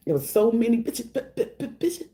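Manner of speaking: a hint of mock beatboxing; beatbox stutter. Mocking the woman who beatboxed insults, lightly stuttered. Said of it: "It was giving beatbox".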